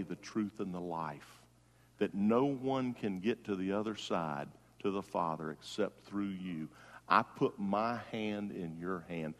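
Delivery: prayer. Acted. Preacher leads the congregation in a sinner's prayer, soft and low. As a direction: pray it gently as if kneeling.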